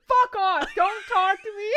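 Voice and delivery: high, loud laughing yell; mock drunken shout. Drunk girl yelling at a concerned stranger, loud and laughing. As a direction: scream it like a mean drunk. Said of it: "I'm like".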